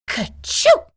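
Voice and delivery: high, loud squeaky sneeze. A narrator's reading. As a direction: burst out a little rabbit's sneeze. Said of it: "Peter sneezed".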